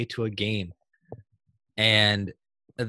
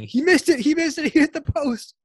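high-pitched imitation of a dad's yell; 4 dB louder than usual. Retelling dad's play-by-play of the shootout, imitated yelling. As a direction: yell it like a dad giving shootout commentary. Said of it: "my dad yelling".

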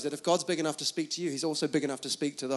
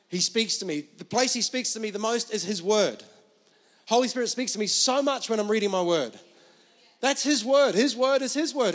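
loud, driving sermon delivery; full-force preaching; 6 semitones higher, 6 dB louder than usual. Acted. Preacher urging the congregation to hear God, full-force preaching. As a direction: preach it with urgent conviction.

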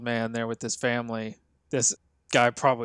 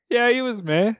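unimpressed; 10 semitones higher, 4 dB louder than usual. Retold or imitated. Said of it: "being like".

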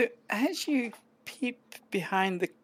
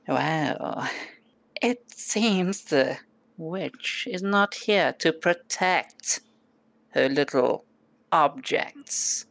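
slow, measured sly goblin voice; lightly voiced scheming goblin; calm goblin; much slower than usual. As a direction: drawl like a smug little goblin. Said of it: "he says".

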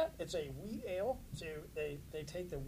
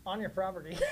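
ordinary talk, nothing marked

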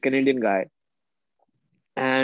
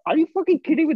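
high, incredulous outburst; stunned disbelief; 13 semitones higher, 4 dB louder, faster than usual. Real, not acted. Amazed kangaroos roam the city, high disbelieving outburst. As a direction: pitch shoots way up in shock.

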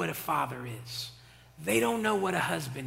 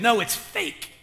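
ordinary talk

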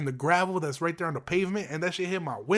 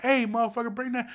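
higher-pitched mock yell; calling after someone; 6 semitones higher than usual. Property manager calling after bike thief, retold yell. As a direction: call out after a runaway thief, not full volume.

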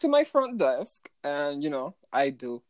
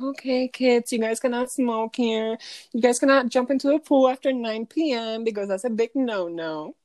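put-on talking to a five-year-old; 6 semitones higher than usual. Hotel clerk lecturing smoking guests like five-year-olds, patient and higher. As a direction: spell it out as if to a kindergartner. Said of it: "like I'm talking to a five-year-old".

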